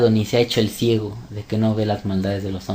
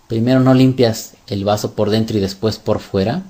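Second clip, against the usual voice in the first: ordinary delivery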